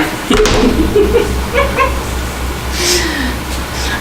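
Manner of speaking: silent, airy wheeze of laughter; breathless wheezing giggle; unvoiced wheezy laugh. Real, not acted.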